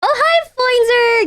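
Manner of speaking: sing-song hello to the pet; cutesy pet greeting. Real, not acted.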